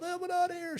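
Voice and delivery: high-pitched mock girl voice; panicky girl. Imitating the girl fleeing the stalled car, high mock panic. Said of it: "the girl jumps up".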